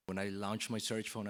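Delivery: ordinary talk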